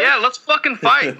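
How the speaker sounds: mock fight challenge. Accepting a pretend fight from his cohost. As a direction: act tough as a joke.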